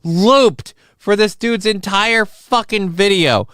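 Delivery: angry rant. Real, not acted. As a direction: spell out the outrage word by word. Angry rant at looped laughter.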